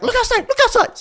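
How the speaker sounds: high, urgent retold yell; frantic mom. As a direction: imitate mom shrieking at the window. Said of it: "she's like".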